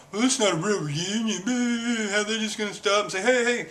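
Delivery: high-pitched mock griping; mocking whiny complainer. Mocking readers who gripe about the reunion, whiny imitation. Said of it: "A lot of people have been bitching about".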